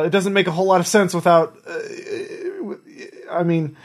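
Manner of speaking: high, panicked pleading; begging for my life. Acted. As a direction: beg for your life, words tumbling out. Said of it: "he is begging for his life".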